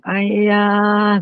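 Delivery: put-on light, high child's call of "Ayah"; a small child greeting dad at the door; little child calling dad. A child happily calls out to the tired father coming home, voiced high and light. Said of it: "anak kecil bilang".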